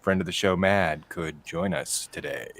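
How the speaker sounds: very low monotone with slight slips of inflection; robotic low host sign-off; Chad impression. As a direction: deliver sincere thanks in a low deadpan drone. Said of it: "I was inflecting a little too much".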